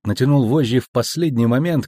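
ordinary delivery, nothing marked

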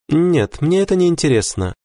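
high female character voice, quick finish; dismissive wife. A narrator's reading. Wife turning down husband's company, dismissive. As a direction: refuse him in a high, composed voice.

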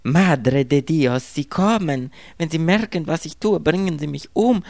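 high, loud terrified woman; hunted woman screaming down the phone; panicked cry. A narrator's reading. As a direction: cry out as they come for her.

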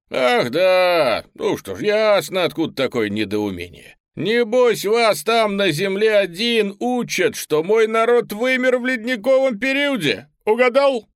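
very high, loud dinosaur voice; smug raptor lecturing. Acted. Raptor voice explaining.

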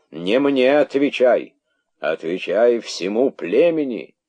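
loud, slow, high order; commanding. A narrator's reading. King ordering the herald to face the tribe, commanding. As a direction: order him sternly, drawing it out.

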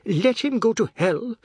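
high, loud tearful outburst; acted sob, pitched right up; sobbing. A narrator's reading. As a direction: cry it out as a wail. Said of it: "Missus Tulsi sobbed".

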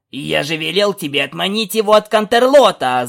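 loud yell; yelling back. A narrator's reading. Stallion yelling back at Scootaloo, loud angry shout. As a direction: bellow it back at her. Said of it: "заорал в ответ жеребец".

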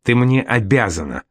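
ordinary delivery

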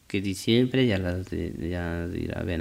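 mock patrona writing; quiet dictation. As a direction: murmur it back as you write. Boss repeats dictation while writing.

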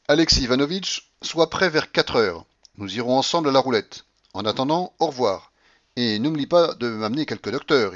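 put-on bossy old grandmother. Grandmother gives Alexis his afternoon orders, bossy. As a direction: give orders briskly in parting.

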